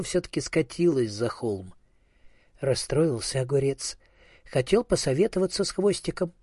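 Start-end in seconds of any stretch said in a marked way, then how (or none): none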